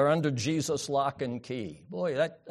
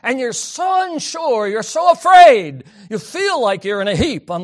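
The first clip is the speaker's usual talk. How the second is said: pleading emphasis; 7 semitones higher, 10 dB louder than usual. Acted. Preaching on fear.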